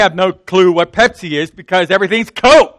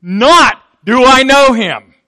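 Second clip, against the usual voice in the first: "Not" thrown out loud and high, drawn out; slow, forceful denial leading with NOT; stress on NOT; 4 semitones higher, 6 dB louder, slower than usual. Acted. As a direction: punch "Not" hard, then stretch the rest. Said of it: "that was how the Greek says it".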